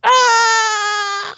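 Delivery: high, loud acted scream; mock scream. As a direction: scream like a stabbed victim. Retelling a victim's scream while hacked.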